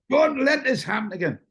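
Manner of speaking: high, stern final warning. Retold or imitated. Boss warning his driver, high and stern. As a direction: lay down the law.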